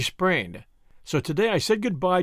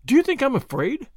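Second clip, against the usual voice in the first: high-pitched indignant question; 10 semitones higher than usual. A narrator's reading. Tuppence answering Julius's warning about the crooks, indignant. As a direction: bristle at the suggestion, voice leaping up. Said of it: "said Tuppence indignantly".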